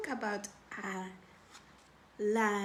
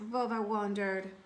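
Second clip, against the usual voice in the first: ordinary delivery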